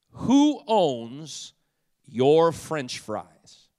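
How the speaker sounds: emphatic preacher with dramatic pauses; loud, drawn-out key words; mock-solemn preaching. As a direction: proclaim a weighty question to the congregation. Launches the sermon question.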